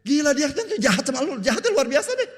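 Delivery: high, loud, outraged neighbour voice; a hammed-up indignant cry; mock outraged shout. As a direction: shriek it out in mock disbelief. Preacher acting out a hostile person.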